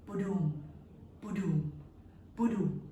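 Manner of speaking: low soft boom boom; low heartbeat booms. Acted. Heartbeat imitated.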